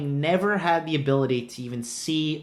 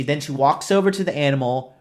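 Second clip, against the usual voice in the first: ordinary delivery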